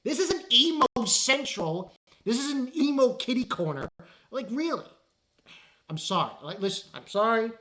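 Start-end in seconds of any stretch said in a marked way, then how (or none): 0.0-3.9 mock exasperation. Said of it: "I'm like".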